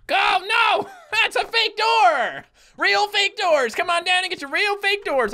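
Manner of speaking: hammed-up shouting. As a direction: holler it like an over-the-top pitch. Calling out about fake doors.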